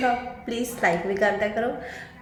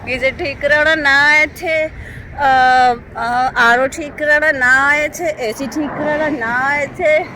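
higher and louder, listing every chore; loud ranting list; 5 semitones higher, 7 dB louder than usual. Real, not acted. Vlogger telling viewers about endless chores in India, raised voice.

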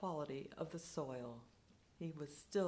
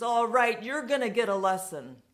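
loud, higher, quicker threat; drunken threat; 5 semitones higher, 12 dB louder than usual. Retold or imitated. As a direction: spit out the threat as he squares up.

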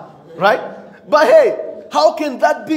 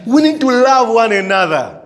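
ordinary talk

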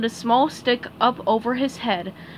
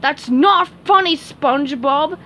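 angrily; 4 semitones higher, 6 dB louder than usual. Acted. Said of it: "said Sandy angrily".

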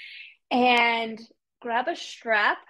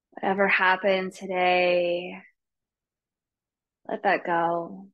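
guided meditation; 3 semitones lower than usual. Real, not acted. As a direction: ease them gently into stillness, unhurried. Leads the class into meditation.

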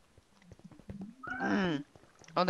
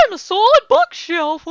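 goofy silly voice; 14 semitones higher, 6 dB louder than usual. Pretend. Goofy voice pretending to be block.

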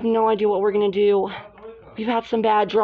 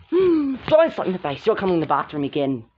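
normal talking voice

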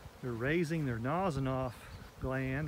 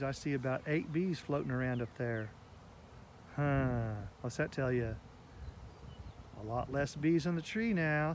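ordinary talk, nothing marked

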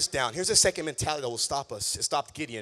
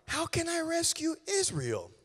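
Gideon; 10 semitones higher than usual. Acted. Gideon protesting to the Lord.

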